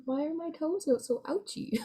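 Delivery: put-on high, childish little whine; mock whining. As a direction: whimper playfully about a sore toe. Mock whine about sore toes.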